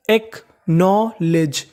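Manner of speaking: Acknowledge very slowly, split as एक - नौ - लेज; syllable by syllable. Shown as a demonstration. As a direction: sound out acknowledge part by part. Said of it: "तो meaning बनी". (Acknowledge को तोड़-तोड़कर धीरे)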